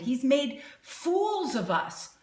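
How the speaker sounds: ordinary delivery, nothing marked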